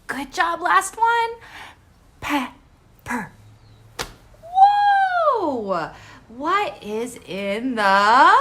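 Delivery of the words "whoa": high, loud whoa of delight; surprised whoa. Real, not acted. Teacher reacting to the laughing egg with a surprised whoa. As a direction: gasp it out in wonder.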